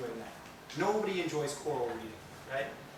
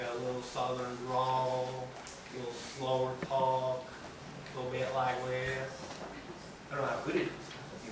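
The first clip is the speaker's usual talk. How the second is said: southern drawl; 4 semitones lower, 5 dB quieter than usual. Shown as a demonstration. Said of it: "Farmer Ted, southern drawl".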